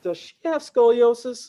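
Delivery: high, loud, slow clinical question; puzzled doctor. Retold or imitated. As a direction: ask it like a worried chiropractor. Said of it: "looking at the dance going".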